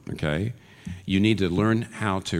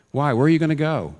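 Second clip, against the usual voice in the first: raised, louder pleading question; 8 semitones higher, 5 dB louder than usual. Real, not acted. Preacher pressing his listeners, raised pleading question.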